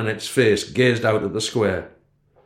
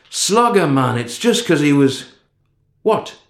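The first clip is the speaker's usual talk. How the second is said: raised voice over traffic; yelling; 5 semitones higher, 3 dB louder than usual. A narrator's reading. Boy yelling at his friend over the bypass traffic, raised voice. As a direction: shout it over the roaring traffic. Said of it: "I didn't mean to yell at him".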